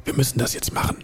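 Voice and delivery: urgent hushed whisper; whispering while hiding. Acted. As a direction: breathe it out unvoiced, urgent. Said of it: "Es wurde geflüstert von wegen".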